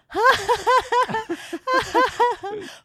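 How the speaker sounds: high squealing giggle. Retold or imitated. Bride seeing her teary groom, high squealing giggle. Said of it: "and I'm like".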